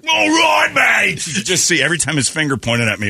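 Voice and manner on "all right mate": put-on shouted, much higher Australian accent; screaming Australian. Australian diver pointing at his mate, screaming in an Australian accent. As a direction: yell it like an Aussie diver gone wild. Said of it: "a screaming Australian".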